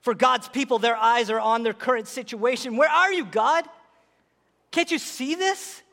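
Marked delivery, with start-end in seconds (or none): 2.8-5.8 higher-pitched, questioning plea to God; pleading cry. Acted. God's people looking at their situation, pleading. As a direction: plead upward, stretching out the last question.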